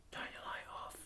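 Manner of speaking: breathy unvoiced whisper of an order. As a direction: hiss it under your breath, no voice at all. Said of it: "we're whisper".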